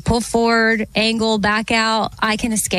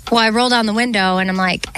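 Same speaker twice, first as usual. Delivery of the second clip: usual speaking voice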